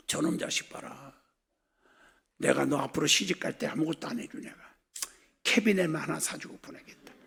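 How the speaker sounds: low, soft inner grumble of a father; lightly voiced father, privately unimpressed; displeased father's thoughts. Father thinking about his complaining daughter, low displeased murmur. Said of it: "불평 불만하니까 아버지 마음속에".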